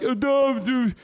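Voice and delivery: sneering impression of someone shrugging it off; dumb apologist voice. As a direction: play the clueless defender, mocking him. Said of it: "you're like".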